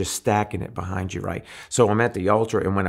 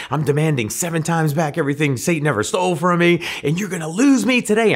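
fired up; 8 semitones higher, 5 dB louder than usual. Retold or imitated. Fired up rebuking Satan while driving.

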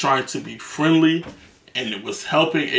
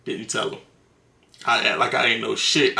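normal talking voice

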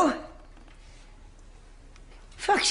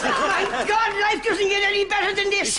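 overjoyed; 10 semitones higher than usual. Acted. Overjoyed at triplets news.

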